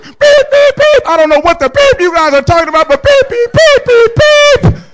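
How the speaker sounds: very high, loud, fast string of bleeped curses; bleeped tirade. Retold or imitated. Dad going through the roof at his family, bleeped tirade. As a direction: blow up, beep after beep. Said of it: "I just went through the roof".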